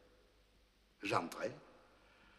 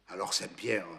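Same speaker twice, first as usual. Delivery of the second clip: ordinary delivery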